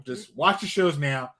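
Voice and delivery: ordinary delivery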